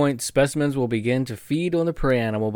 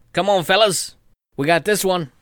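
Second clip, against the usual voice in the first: crab gang leader; 7 semitones higher, 5 dB louder than usual. Retold or imitated. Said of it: "they get all the boys in there".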